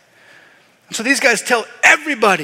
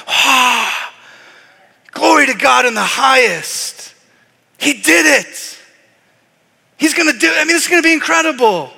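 higher and louder, proclaiming glory; joyful angels proclaiming; 6 semitones higher, 5 dB louder than usual. Acted. Angels appearing to the shepherds, loud joyful proclamation. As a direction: announce it with mounting joy. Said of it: "they show up and they go".

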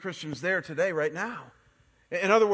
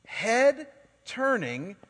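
drawn out slowly; 3 semitones higher, 3 dB louder, much slower than usual. Real, not acted. Drawn out for the congregation.

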